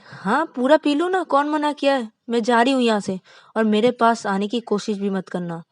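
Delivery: high, loud biting sarcasm; mocking angry outburst; sarcastic angry shout. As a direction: throw the permission back at him in fury. Wife storms off sarcastically.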